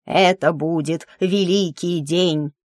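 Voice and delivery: lightly voiced old man, slow and solemn; a hint of a rattly old priest, slowly; old dwarf priest. A narrator's reading. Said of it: "судя по заметному дребезжанию голоса, старик". (слегка старческий голос, медленно и торжественно)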